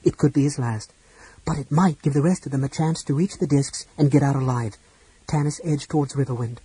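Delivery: plain reading voice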